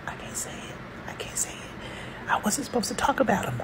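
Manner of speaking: imitated frightened child's whisper; whimpering. Scared daughter confiding in her mother, whimpering. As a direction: whisper it haltingly, as if forbidden to tell. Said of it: "I hear my daughter whimpering".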